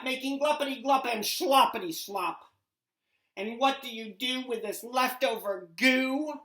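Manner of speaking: the Lorax scolds the factory owner loudly; angry Lorax. Acted. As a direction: lecture him with rising anger. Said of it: "snapped the Lorax, his dander was up".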